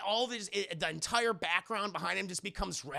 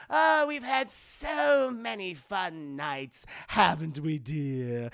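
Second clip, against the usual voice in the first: slow, swooping villain croon; hammed-up doting cartoon villain; Joker; 5 dB louder, much slower than usual. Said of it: "And Joker's like".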